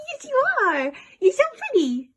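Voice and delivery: high cooing pet talk. Real, not acted. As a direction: gush over a pretty pet.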